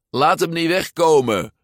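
high, loud urgent shout. A narrator's reading. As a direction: call out to the crowd. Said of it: "riep iemand". (luid roepend)

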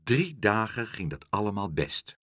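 usual speaking voice

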